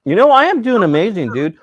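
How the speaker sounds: high, loud gushing answer; excited reply. Real, not acted. Telling Samantha how he's doing, high and gushing. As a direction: sell how amazing it is.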